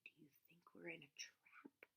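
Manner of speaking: hushed, breathy whisper; nervous whisper. Acted. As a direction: whisper the fear close to him. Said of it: "he went on nervously".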